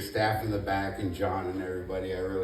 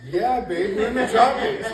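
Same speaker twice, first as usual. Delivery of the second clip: laughing reply; 11 semitones higher, 10 dB louder than usual. Retold or imitated. Answers wife, laughing.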